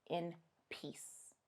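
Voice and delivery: whispered. Pretend. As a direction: whisper the last word like a farewell. Ghosts told to rest in peace.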